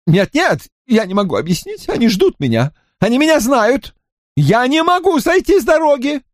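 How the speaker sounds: high, panicked protest; pleading Drawlight. A narrator's reading. Drawlight excusing himself to Lascelles, high and panicked. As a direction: beg to be believed.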